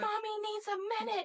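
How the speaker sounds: high-pitched mock mom plea; pleading mommy voice. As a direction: play a frazzled mommy asking for space. Voicing a mom begging for space.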